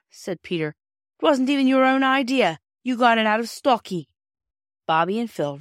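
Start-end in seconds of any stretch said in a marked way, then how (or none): 1.2-4.1 put-on loud, high childish accusation; squabbling boy, pitched up and scornful; scornful taunt. A narrator's reading. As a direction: jeer at a sibling. Peter taunts sister in quarrel.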